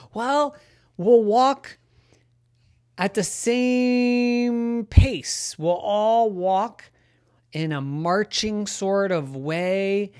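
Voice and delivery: high, slow goose explaining. Acted. Ricardo explains a parade.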